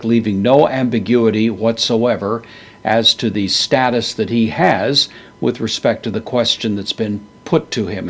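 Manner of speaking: ordinary delivery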